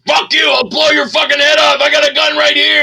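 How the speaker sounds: high, loud mock thug threat; a guy at the Coke machine threatens him, hammed-up shouting; threatening thug. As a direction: yell it like a street punk pulling a gun. Acting out the armed thug.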